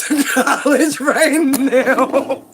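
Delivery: words pulled long through laughter; laughing. Real, not acted. Laughing as she sends him off to college. Dismissing young man, laughing.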